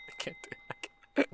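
breathless, slow laughter through the words; giving up, wheezing with laughter; cracking up after the game scared him; helpless laughing. Real, not acted.

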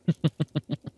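short run of bubbling laughter; amused chuckle. Acted. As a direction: chuckle at the very idea. Amused at being thought a builder.

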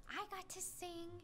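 usual speaking voice

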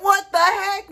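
high-pitched, comic disbelief; mock exasperation. Reacting to God's order to flush, mock exasperation.

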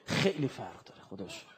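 quiet. Shown as a demonstration. Preacher murmuring خیلی فرق داره to mourners, hushed. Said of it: "repeated with different force".

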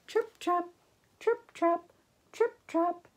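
high, crisp sing-song beats; tap-dancing hooves. A narrator's reading. Storyteller imitates goats tap dancing, high rhythmic beats.

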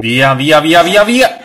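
urgent urging. Real, not acted. Player urging a getaway from danger, urgent and rising. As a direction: urge flight with mounting loud repeats. Urging escape from enemies in game.

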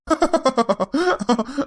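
high, mocking laugh at her; teasing laugh. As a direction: laugh at her tears to tease her. Husband teases his crying wife.

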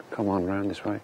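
ordinary talk, nothing marked

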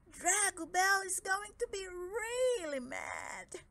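high, slow, worried dragon voice; fretful dragon. Acted. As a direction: fret like a nervous dragon. Dragodad frets about wife.

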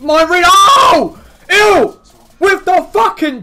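building to a high, loud scream; excited shout. Real, not acted. Commentator as Ilt turns the fight, erupting into a shout. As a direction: erupt mid-sentence at the sudden turn. Live call of sudden turn.

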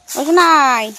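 loud call with the name drawn out. Real, not acted. Parent calling to a child by the pond, loud call.

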